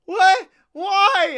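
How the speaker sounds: high, loud cry of disbelief; mock outraged boy. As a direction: imitate a boy's indignant yelp. Retelling the sprayed boy's reaction.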